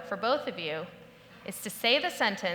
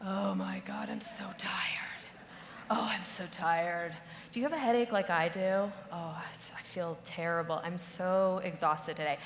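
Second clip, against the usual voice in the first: mock exhausted moaning; 5 semitones lower, 8 dB quieter than usual. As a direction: sigh it out like you're drained. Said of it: "this was my lead to the conversations".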